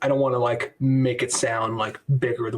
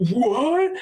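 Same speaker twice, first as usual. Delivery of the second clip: high-pitched incredulous "what"; mock astonishment; 12 semitones higher, 3 dB louder than usual. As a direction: react in hammed-up disbelief. Acted movie-style stunned reaction.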